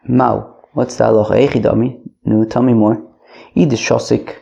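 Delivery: ordinary talk, nothing marked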